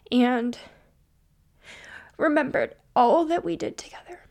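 choked up, words half whispered through tears; crying. Real, not acted. Podcaster remembering her late best friend, crying. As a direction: voice breaking, fighting back real tears. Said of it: "here I am g-, crying again".

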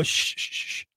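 soft unvoiced shush; gentle shh to calm an inner voice; shushing the ego. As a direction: hush it like a fussy child. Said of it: "and I go".

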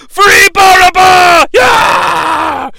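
high, loud, slow shout of "Free"; crowd roar. Retold or imitated. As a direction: roar it like a frenzied mob. Crowd roars for Barabbas.

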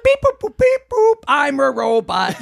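high beeping robot voice; toy robot voice. Pretend. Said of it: "Robot, robot noise".

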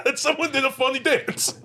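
squeaky-high, slow words through laughter; laughing. Real, not acted. Host finishing the bit about a funny dance, helpless with laughter. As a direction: struggle through the sentence while laughing.

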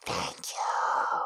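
silently mouthed thank-you, all breath; mouthed whisper. Acted. Reader mouthing thanks across the bar, barely whispered. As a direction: whisper it as if only mouthing the words. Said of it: "mouthing the words".

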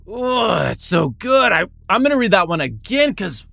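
high, loud, thrilled outburst; gushing with real excitement; excited. Real, not acted. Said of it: "Man, I'm excited".

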